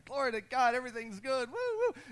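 high, cheering praise ending in whoops; mock exultation. Shown as a demonstration. The preacher voices a faith-filled crowd, cheering high. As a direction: whoop it up like a happy believer. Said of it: "We're gonna say".

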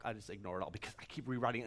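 ordinary talk, nothing marked

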